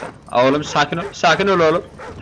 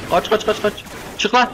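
high urgent run-run-run; urgent high call; 8 semitones higher than usual. Real, not acted. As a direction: hurry everyone out, pitch high. Urging escape under fire. (yüksek perdeden telaşlı kaç komutu)

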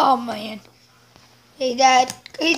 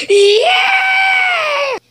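triumphant yell; 5 dB louder than usual. Acted. Big finish to a chant.

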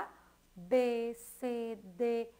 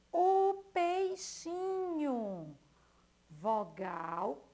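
slow syllable by syllable; 3 semitones higher, much slower than usual. Shown as a demonstration. Teacher to young pupils, very slowly sounding out O peixinho. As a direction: draw the word out for children to hear its parts. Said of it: "Pei, consoante, vogal, vogal".

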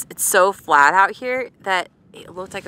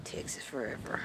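whispered; 5 semitones lower, 16 dB quieter than usual. Real, not acted. Echoing the phone call's sign-off.

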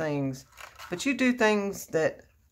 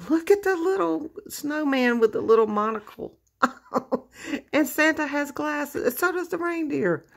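delighted squeal; 9 semitones higher, 3 dB louder than usual. Real, not acted. Delighted at a gift.

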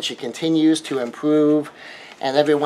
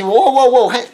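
startled wife; 7 semitones higher, 4 dB louder than usual. Acted. Wife pushes back at attack.

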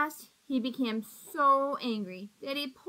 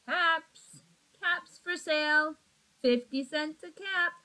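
louder peddler's street call; 3 semitones higher, 4 dB louder than usual. Acted. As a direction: cry your wares down the street. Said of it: "walked up and down the street calling".

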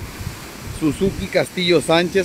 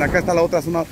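ordinary talk, nothing marked

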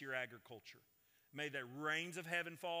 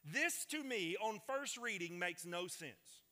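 higher, emphatic preacher's delivery; emphatic sermon voice, pitched up; forceful preaching; 8 semitones higher, 6 dB louder than usual. Real, not acted. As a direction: drive the argument home with a raised voice.

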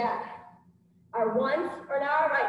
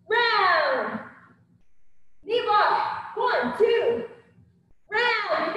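boxing coach calling combos; 6 semitones higher, 4 dB louder, much slower than usual. Acted. Instructor calling combo reps.

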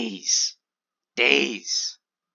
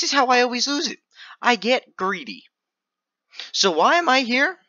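excited; 11 semitones higher than usual. Real, not acted. Excited self-talk.

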